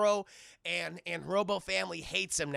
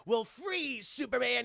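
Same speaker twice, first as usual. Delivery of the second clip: pitched-up playful challenge; boy playing hero; 6 semitones higher, 4 dB louder, slower than usual. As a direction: imitate a kid playing cops.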